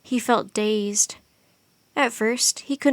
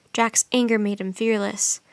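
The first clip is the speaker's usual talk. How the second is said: usual speaking voice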